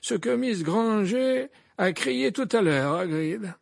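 put-on high, loud old wizard voice; gently probing old headmaster; Dumbledore voice. A narrator's reading.

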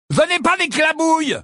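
loud, high outburst; indignant protest. Acted. Ron rejects the portrait's diagnosis, indignant.

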